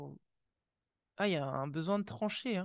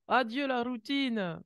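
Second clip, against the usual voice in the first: higher, louder, slow proclamation; excited announcement; 6 semitones higher, 6 dB louder, slower than usual. Real, not acted. Tarot reader announcing the card to viewers, excited and slow. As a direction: proclaim it like big news.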